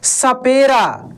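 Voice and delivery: सपेरा said slowly with a dental स; slow clear demo. Shown as a demonstration. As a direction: draw out सपेरा, स off the teeth. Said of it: "दंत स का उच्चारण दास से होता है". (सपेरा धीरे, दाँत से स बोलते हुए)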